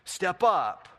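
teasing challenge. Retold or imitated. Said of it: "you can go to your husband like".

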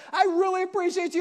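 lavish praise on the dog; excited praise. Retold or imitated. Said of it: "he's so excited".

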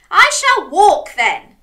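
loud, high, slow announcement; proclaiming. A narrator's reading. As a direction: announce it grandly as the crab. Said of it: "announced Crab".

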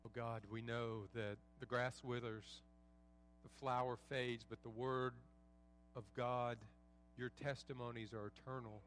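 softly spoken, low pastoral prayer. Real, not acted. Pastor praying for struggling believers in the room, hushed prayer.

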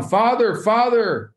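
high-pitched calling out to a far-off father; mock scream. Prince calling his father over the crowd, mock screaming. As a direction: cry out "father" high, without full volume. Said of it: "he's screaming as loud as he can".

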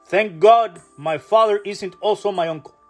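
ordinary talk, nothing marked

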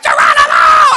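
high, loud yell; kid's battle cry. Retold or imitated. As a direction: leap off the slide yelling. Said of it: "And he's like".

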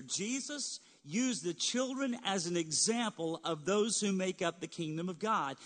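ordinary talk, nothing marked

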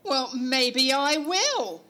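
slow, loud threat; threatening. Acted. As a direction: push back slowly and loudly. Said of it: "it would be more interesting if I got violent".

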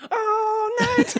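high, loud sung-out cry; imitated door-kicking holler. Regular kicks the shop door open, mock-dramatic high holler. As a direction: burst in bellowing high. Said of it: "kicked the door open. He's like".

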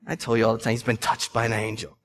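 low, soft imitation of teasing; mocking imitation. Dudes making fun of him, low mocking imitation. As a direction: tease under your breath, pitched well down. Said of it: "Dudes were making fun of me".

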